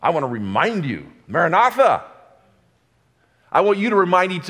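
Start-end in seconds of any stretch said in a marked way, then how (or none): 1.3-2.0 ringing high call of Maranatha. Real, not acted. Preacher calling Maranatha out to the church, raised high. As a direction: lift Maranatha up to the congregation.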